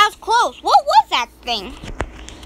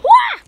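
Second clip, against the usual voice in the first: high yelp; 5 dB louder than usual. Real, not acted. Surprise in game.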